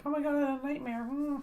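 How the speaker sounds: high, lightly put-on dramatic whine; mock whiny voice. Mocking a bland nightmare story, high put-on whine. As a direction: parody a basic nightmare story. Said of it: "I'm not talking about".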